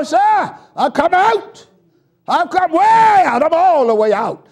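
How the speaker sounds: loud, fast, high preaching cadence; whooping preacher build; preaching at full force. Real, not acted. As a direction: drive the sermon home to the church.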